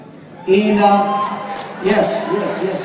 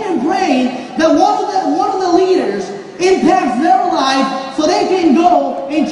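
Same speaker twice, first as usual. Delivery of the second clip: loud, high, driving motivational preaching; preaching at full force; 6 semitones higher, 6 dB louder than usual. Acted. Motivational speaker rallying the audience, loud full-force preaching. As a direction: hammer every line home to the crowd.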